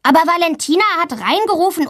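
quick high tattletale grumble; a schoolboy complaining to the teacher, fast and high; whiny complaint. Acted. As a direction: tell on her, fast and whiny. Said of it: "Max meckert".

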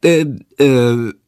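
halting stammer ending in a sputter; boy stuck for the name, stammering; stuttering boy. A narrator's reading. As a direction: stumble over nothing, stuck. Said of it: "Jendrik stottert".

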